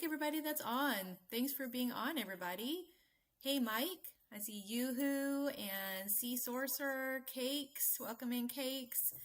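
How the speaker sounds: ordinary talk, nothing marked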